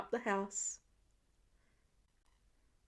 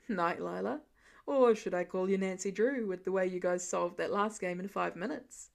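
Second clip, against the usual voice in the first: lower teasing dad; 5 semitones lower than usual. A narrator's reading. Dad teasing daughter goodnight.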